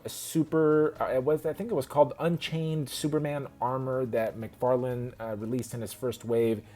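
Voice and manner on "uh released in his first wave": normal talking voice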